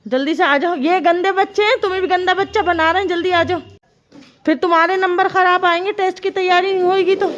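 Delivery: loud, high-pitched nagging call; urgent scolding. Real, not acted. Mother warns child about bad marks, scolding.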